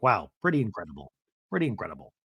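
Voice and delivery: low, soft, underwhelmed mock awe; Todd, deadpan. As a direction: drop low and quiet, imitating a man impressed. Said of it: "This guy's just like".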